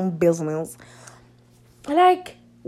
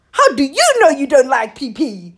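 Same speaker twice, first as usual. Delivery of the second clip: mock-indignant question, a bit raised; incredulous echo; 3 semitones higher, 4 dB louder than usual. Repeating his question back at him, incredulous. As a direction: throw the question back in disbelief.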